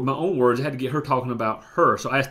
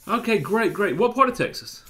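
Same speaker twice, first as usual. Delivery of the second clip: upbeat friendly; 6 semitones higher than usual. Retold or imitated. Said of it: "I said".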